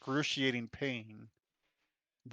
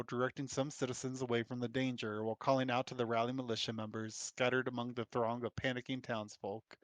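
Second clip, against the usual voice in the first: ordinary talk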